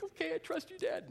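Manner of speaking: put-on high-pitched small child voice; little boy. Three-year-old answering dad before shot, little-boy voice. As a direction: imitate a trusting toddler, high.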